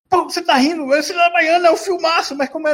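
high-pitched mock indignation; exasperated high-voiced mimicry; imitating annoyed outburst. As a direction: act out someone getting worked up, voice jumping high. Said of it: "ele fica puto, sabe?".

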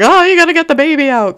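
high, quick imitation of mom; mock alarmed mom. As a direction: jump up high and rush it like mom. Mom's alarmed reply, retold.

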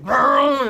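loud, revving car noise; imitated engine roar. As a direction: rev it like a car zooming in. Imitates a car pulling in fast.

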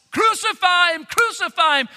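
high, loud chant of an angry crowd; mob shouting. Acted. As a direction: shout it out like a mob demanding blood. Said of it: "Pilate hears constant shouting from outside".

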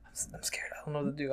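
hushed, retold nervous aside; scared whisper.

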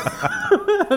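sudden high-pitched cackle; high laugh. Real, not acted. Guest laughs at his own joke, high cackle.

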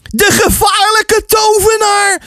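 high, drawn-out alarm cry. A narrator's reading. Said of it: "riep Ties uit".

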